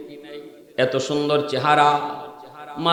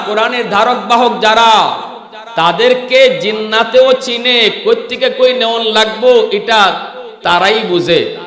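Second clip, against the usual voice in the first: fiery preaching; 6 semitones higher, 6 dB louder than usual. Acted. Preacher telling the congregation the jinn know Quran bearers, fervent. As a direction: proclaim it like a revival sermon. Sermon at full force.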